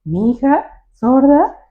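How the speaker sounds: mamá indignada, más alto y agudo; incredulidad sorprendida. Retold or imitated. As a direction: imitando a la mamá que no se lo cree. Mamá indignada ante la maestra. (la mamá sorprendida le responde fuerte a la maestra)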